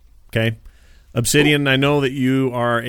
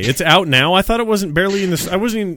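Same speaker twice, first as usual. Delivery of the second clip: surprised; 7 semitones higher than usual. Real, not acted. Surprised game is out.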